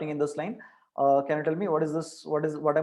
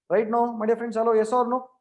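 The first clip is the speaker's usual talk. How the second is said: calling out to class; 6 semitones higher, 4 dB louder than usual. Real, not acted. Teacher calls out to silent class.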